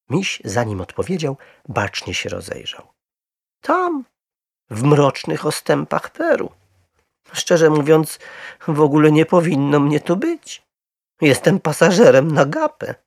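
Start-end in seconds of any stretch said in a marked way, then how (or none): 3.6-13.0 high, loud, earnest bear voice; little bear confiding. A narrator's reading. Little bear confessing his origins to the Browns, earnest.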